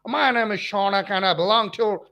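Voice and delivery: mock American movie-star accent; Tom Cruise. Teacher showing students what not to copy, mock American accent. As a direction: put on a run-together, exaggerated American accent. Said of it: "don't have to speak like Tom Cruise".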